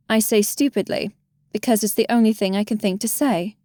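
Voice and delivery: plain reading voice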